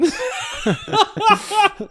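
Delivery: loud, very high burst of laughter; big laugh. Real, not acted. Climber cracking up over the beaches misunderstanding, loud high laughter. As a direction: crack up at your own story.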